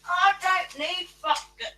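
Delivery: talking around a cigarette in mouth; words muffled by a cigarette in mouth. Real, not acted. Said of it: "sorry, I had a cigarette in my mouth then".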